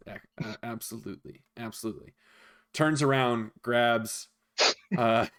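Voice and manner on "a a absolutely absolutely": hushed innkeeper. Acted. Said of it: "And he says".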